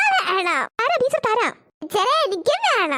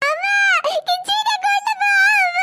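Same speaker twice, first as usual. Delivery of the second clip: very high tearful wail; shrieking schoolboy calling mom; wailing child; 11 semitones higher than usual. Acted. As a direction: cry out after mom as she goes. Child wailing after mother.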